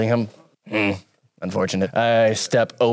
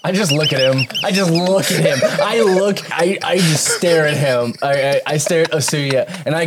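laughing while talking; 8 semitones higher, 4 dB louder than usual. Real, not acted.

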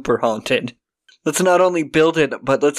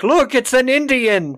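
mock excited yell; 10 semitones higher than usual. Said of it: "they're like".

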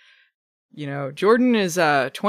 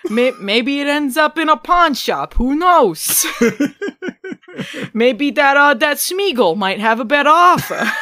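smiling menacing loan shark; 8 semitones higher, 6 dB louder than usual. Pretend. Continues acted scene.

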